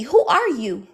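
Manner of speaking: ordinary delivery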